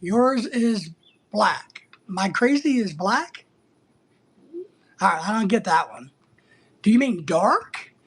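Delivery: ordinary talk, nothing marked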